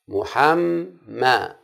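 محمى stretched very slowly, syllable by syllable; drawn-out syllables. Shown as a demonstration. Teacher sounding out a word for pupils, slowly stretched. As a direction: spell محمى out in slow sound pieces. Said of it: "حاء حاء مفتوحة".

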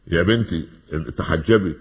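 ordinary talk

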